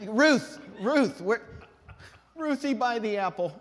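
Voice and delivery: high, loud mock-desperate call; hammed-up helpless plea; calling for help. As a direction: call out to Ruth to rescue you. Acts out calling Ruth for help.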